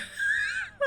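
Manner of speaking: high screaming laugh; shrieking laugh. Real, not acted. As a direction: cackle and shriek with glee. Gleeful over changing title.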